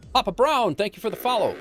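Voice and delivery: bright, loud, high thank-you; excited shout-out. Real, not acted. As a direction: cheer the follower's name. Streamer thanks a new follower.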